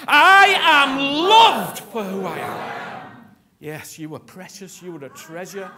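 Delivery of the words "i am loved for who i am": slow, loud, ringing proclamation; bold declaration. Shown as a demonstration. Preacher modelling the declaration for the congregation, loud and slow. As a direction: shout it out once more. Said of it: "do it like that".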